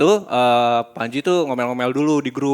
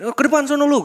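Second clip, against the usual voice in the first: much higher, louder brush-off; dismissive shout; 13 semitones higher, 5 dB louder than usual. Retold or imitated. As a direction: bark him to the front.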